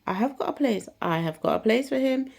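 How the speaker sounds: excited announcement. Retold or imitated. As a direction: announce the good news gleefully. Teacher announces place.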